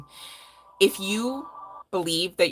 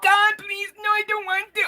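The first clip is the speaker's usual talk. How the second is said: mock pleading; 5 dB louder than usual. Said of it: "The whole time you're like".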